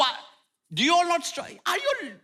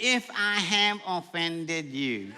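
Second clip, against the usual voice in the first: slow, snooty fake apology; a sniffy non-apology; mock half apology; 4 semitones lower, 3 dB quieter, much slower than usual. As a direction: say it grudgingly, like you don't mean it. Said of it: "Don't give no half apologies".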